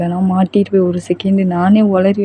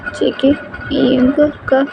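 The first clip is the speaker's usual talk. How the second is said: put-on high, slow voice of an upset girlfriend; higher voice, slowed by upset; 6 semitones higher, slower than usual. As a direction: make the slow call sound not quite okay. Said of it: "எதுக்கு உன் குரல் இப்படி இருக்கு?".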